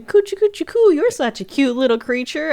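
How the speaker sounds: high sing-song coo; imitated doting baby talk; mock baby-talk cooing. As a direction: coo at a tiny pet. Imitating scientist cooing at creature.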